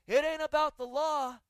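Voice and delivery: ringing pulpit emphasis; emphatic preaching. Real, not acted. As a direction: hammer the point home to the congregation. Preacher drives home his point.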